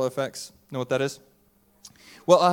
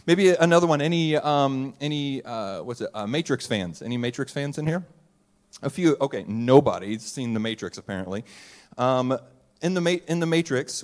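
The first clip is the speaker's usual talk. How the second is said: ordinary talk, nothing marked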